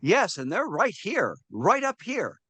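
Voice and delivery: slow, high, swooping retold answer; exaggerated high-pitched reply. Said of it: "I went way up high".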